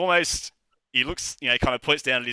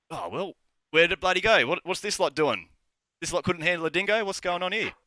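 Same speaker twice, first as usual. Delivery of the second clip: high-pitched, put-out questioning; incredulous demand; 7 semitones higher than usual. Acted. Tavern owner hearing of the dingo, incredulous. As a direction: question the useless lot in raised pitch.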